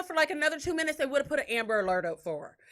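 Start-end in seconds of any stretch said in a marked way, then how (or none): none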